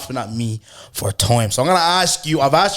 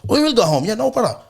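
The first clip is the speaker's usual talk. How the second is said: cheeky; 11 semitones higher, 5 dB louder than usual. Retold or imitated. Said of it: "I'm getting thick now".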